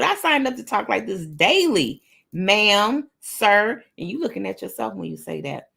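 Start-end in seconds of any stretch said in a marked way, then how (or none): 0.0-3.8 full of sass; with attitude. Shown as a demonstration. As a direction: say it with attitude, hitting "ma'am" and "sir". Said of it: "say it with attitude".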